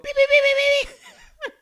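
high loud alarm beeps; alarm beeping. Retold or imitated. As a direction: mimic a door alarm. Imitates alarm sound.

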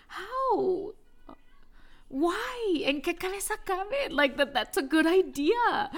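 ¿how could he? incredulous, pitched high; incredulous exasperation. Retold or imitated. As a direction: throw up your hands at the absurdity. Exasperated at husband's logic.

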